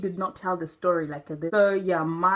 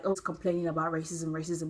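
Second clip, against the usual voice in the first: quick, quieter mocking whine; mock complaining; 4 semitones lower, 7 dB quieter, faster than usual. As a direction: toss it off like a tired broken record. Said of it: "We don't wanna sound like".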